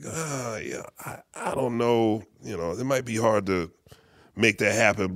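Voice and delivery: low, soft, halting reply; imitated noncommittal grumble; hesitant doubt. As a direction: hem and haw reluctantly. Retells manager's doubtful reply.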